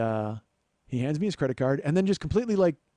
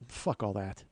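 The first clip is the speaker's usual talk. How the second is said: low dismissive; 7 semitones lower, 8 dB quieter, slower than usual. Retold or imitated. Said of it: "he goes".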